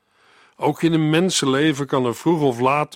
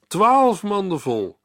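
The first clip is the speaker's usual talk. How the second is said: slow emphatic; 6 semitones higher, slower than usual. Shown as a demonstration. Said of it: "preacher stresses the leftover baskets".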